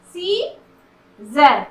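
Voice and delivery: "सीजर" slowly split as see, pause, zer; "सीजर" drawn out syllable by syllable; broken into syllables. Shown as a demonstration. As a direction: say "सीजर" in two separated parts, no C sound. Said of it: "यहाँ पर सी का sound नहीं".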